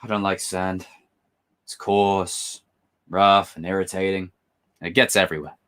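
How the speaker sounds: soft, low brooding Anakin; Anakin line. Retold or imitated. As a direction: mutter it like a sulky young Jedi. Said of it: "I'm gonna do the line".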